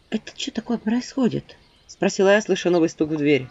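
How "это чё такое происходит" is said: whisper. Acted. Startled by new knock.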